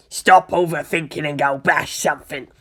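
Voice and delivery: rough-and-ready ork war boss voice. Acted. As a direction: play a brutish warlord giving advice. War boss figurine gives advice.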